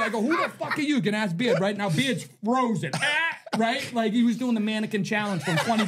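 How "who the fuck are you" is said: defiant retort. Retold or imitated. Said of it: "Just like that".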